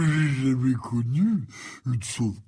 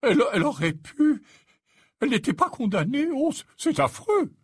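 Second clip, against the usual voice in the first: high, stammering horror; quick, high-pitched old man's distress; horrified; 10 semitones higher, faster than usual. Acted. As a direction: stumble over the words in a high, appalled voice. Slughorn horrified by the mother's death.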